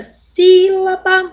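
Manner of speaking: "sílaba" with the first syllable high, long and loud; open accented I. Shown as a demonstration. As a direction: stretch and punch the "sí" in "sílaba". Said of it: "você consegue reparar o acento agudo no I".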